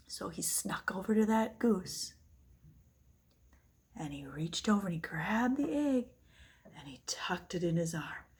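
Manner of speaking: hushed sneaking narration; whispering as Jack creeps to the goose; sneaky whisper. A narrator's reading. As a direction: whisper so the giant won't hear.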